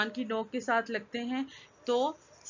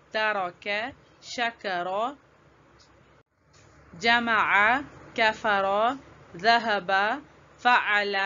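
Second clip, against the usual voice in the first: word drill recitation; much slower than usual. Shown as a demonstration. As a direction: read the list slowly so children can repeat. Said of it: "اب ہم کچھ الفاظ کے ساتھ زبر کی practice کریں گے".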